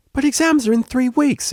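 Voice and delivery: high, loud alarmed outburst; student shocked at being told not to study, loud and high; exclaimed. Acted. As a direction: blurt it out in panic. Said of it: "exclaimed the cellist".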